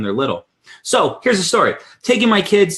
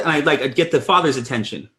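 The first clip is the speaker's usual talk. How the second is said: ordinary delivery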